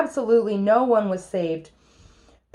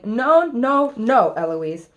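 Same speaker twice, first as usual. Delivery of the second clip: loud scolding that falls in pitch; acted nanny's yell; 3 semitones higher, 6 dB louder, slower than usual. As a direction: burst in and forbid it loudly. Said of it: "Nanny comes in yelling".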